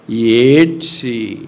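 very slow, stretched delivery; slow mournful pulpit emphasis; drawn-out solemn weeping words. Acted. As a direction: linger over each word mournfully.

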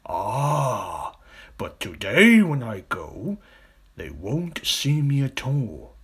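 slow, confident crocodile voice; scheming crocodile. Acted. Big crocodile hinting at his plan, slow and smug. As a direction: savour the secret plan.

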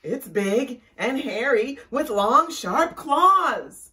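loud, high, panicky storybook wolf; alarmed description. A narrator's reading. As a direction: describe the monster loudly, in alarm. Wolf describes what follows him.

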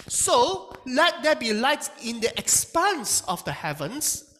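slow, lifted reading of the verse; scripture reading. Acted. Preacher reading Genesis to the congregation, slow and lifted. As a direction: read the verse out slowly, lifted.

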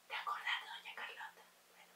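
very soft, quick whisper; whispered aside. Acted. Confiding in the listener about doña Carlota, quick whisper.

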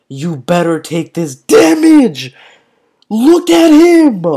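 high, loud, drawn-out shout; loud gamer outburst climbing high; exasperated yelling. Real, not acted. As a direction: yell it slowly at the game. Yells at game opponent.

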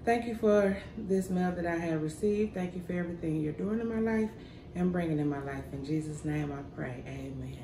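soft, fading prayer. Real, not acted. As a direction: pray quietly, trailing to amen. Says grace before meal.

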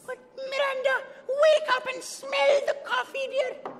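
high, loud nanny exhortation; urgent pleading. Acted. Nanny warning employer about suitor, urgent high plea. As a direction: urge her to see sense, shrilly insistent.

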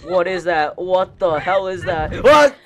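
loud, repeated panicked demand; real alarmed yelling. Real, not acted. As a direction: yell it, peaking in a high shriek. Frightened by something in the game.

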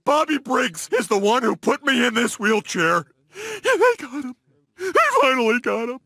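high-pitched emotional outburst; overcome, tearful. Acted. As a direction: let the pent-up hurt break out high. Overcome at enemy's capture.

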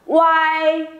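WHY as U run straight into AI, held high and level; joined U-AI glide. Shown as a demonstration. Teacher drilling students, joined-up WHY. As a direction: blend U and AI into one long WHY. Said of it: "Đọc liền vào cho cô nào".

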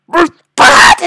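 high, loud burst; exasperated yell. Real, not acted. Gamer stuck on the first part, exasperated yell. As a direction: explode on the last words.

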